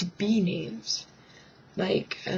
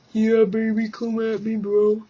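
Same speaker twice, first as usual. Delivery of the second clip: imagined sleazy guy; 2 semitones higher, 5 dB louder than usual. Retold or imitated. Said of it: "He didn't say that, but in my head he did".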